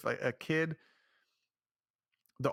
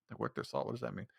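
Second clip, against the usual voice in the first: puzzled whisper; 3 semitones lower, 7 dB quieter, faster than usual. Real, not acted. Puzzled aside to himself.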